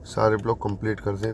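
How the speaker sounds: normal talking voice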